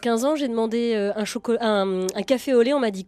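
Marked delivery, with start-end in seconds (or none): none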